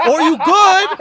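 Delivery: or you good dragged out high and loud, laughing; knowing or you good. Retold or imitated. Friend mocking the classic brush-off, loud and laughing. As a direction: lean hard on good, loud and amused. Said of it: "is universal language for".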